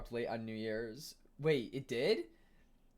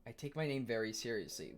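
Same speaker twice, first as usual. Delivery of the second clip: ordinary talk, nothing marked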